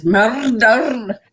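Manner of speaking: "murder" with both R's heavily rolled. Shown as a demonstration. Actress showing the accent's challenge, over-rolled R's on "murder". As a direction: overdo it, trilling each R in "murder". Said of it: "can only roll one of the two R's".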